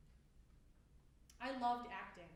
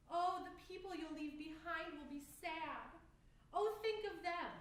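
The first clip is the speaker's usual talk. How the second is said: higher mock-concerned voice, rising in volume; mocking plea; 5 semitones higher than usual. Throwing others' guilt-trip back at them, mocking. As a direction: parrot their pity with scorn. Mimics others' pleas in monologue.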